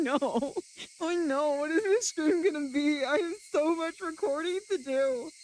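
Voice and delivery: ordinary delivery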